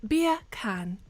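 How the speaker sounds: deliberate British "Beer can"; very British accent. Shown as a demonstration. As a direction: try hard to sound British. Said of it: "trying to sound very British".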